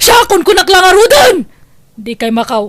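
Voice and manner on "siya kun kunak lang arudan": loud, higher cry of protest; angry outburst. Acted. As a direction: throw the words back at her angrily. Mother snaps in an argument.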